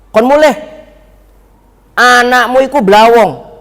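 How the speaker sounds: lightly voiced stern kiai; kiai's order. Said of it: "Begitu ngomongnya".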